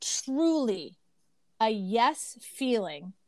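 slowed right down, each word spaced out; slow reading. Real, not acted.

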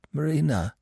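weak, high, labored call; dying gasp of a name; strained effort. A narrator's reading. Gobo lying wounded, calling Marina with effort. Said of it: "he said with an effort".

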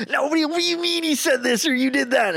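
high, fast, frantic arguing voice; hammed-up frantic outburst; mock heated argument. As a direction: sputter like you've lost the argument. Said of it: "I'm not like".

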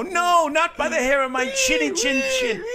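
high, slow, shouted pig voice; hammed-up storybook piglet cry; squeaky little pig. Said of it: "you're gonna go".

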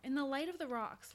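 ordinary delivery

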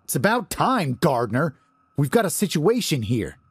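high, urgent command; commanding bark. Acted. Winded Larry orders the guard to act, barking. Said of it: "his own voice came out like a commanding bark".

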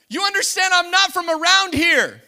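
loud, high shout of the line; preaching at full force. Acted. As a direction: hammer home the foreigner's words. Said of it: "preacher repeats Ruth's line".

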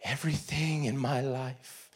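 whispered, seething; bitter whisper. Retold or imitated. Furious teen seething about his dad, whispered. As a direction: hiss it under the breath. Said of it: "and I'm furious".

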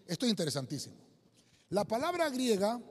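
ordinary delivery

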